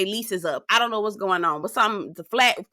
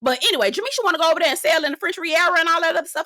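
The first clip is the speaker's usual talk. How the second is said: normal talking voice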